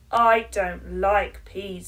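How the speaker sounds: slow, loud flat refusal; stubborn child. Acted. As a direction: dig your heels in like a stubborn little girl.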